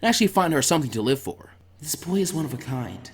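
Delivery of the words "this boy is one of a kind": softer, distant inner voice; as if thinking it quietly to herself; inner thought. A narrator's reading. Haku musing to herself, soft inner voice.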